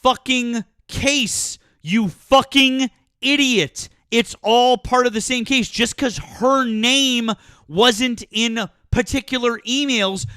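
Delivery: real exasperated anger. Real, not acted. Host berates caller for missing the point, angry.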